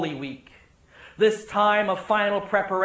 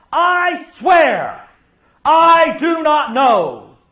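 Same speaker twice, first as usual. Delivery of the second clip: very loud, high, slow sworn denial; frantic denial; 7 semitones higher, 10 dB louder, much slower than usual. Acted. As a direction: hammer every word out, frantic. Climax of acted denial.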